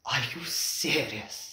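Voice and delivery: quiet, slow, husky question. Acted. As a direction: ask it hoarsely, shaken. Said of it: "he said huskily".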